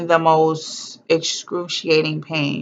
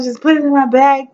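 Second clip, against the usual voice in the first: high, loud desperate demand; pleading loudly for the needle; desperate plea; 9 semitones higher, 5 dB louder than usual. Retold or imitated. Woman in labor lifting her gown, loudly demanding the epidural. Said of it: "I was like, just, like, lifting my gown".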